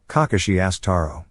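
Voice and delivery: ordinary delivery, nothing marked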